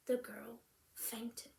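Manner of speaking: ordinary delivery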